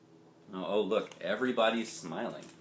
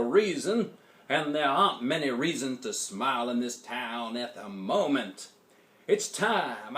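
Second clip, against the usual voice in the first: louder preacher voice, rising to the end; Pastor Butter; 3 semitones higher, 6 dB louder than usual. A narrator's reading. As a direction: preach it to the town hall. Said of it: "Pastor Butter summed up the situation".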